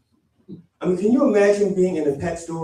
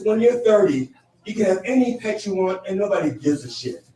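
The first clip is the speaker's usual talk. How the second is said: ordinary talk, nothing marked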